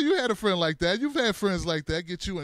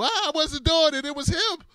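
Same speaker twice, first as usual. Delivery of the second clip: put-on whiny kid denial; 8 semitones higher than usual. Kid denying blame.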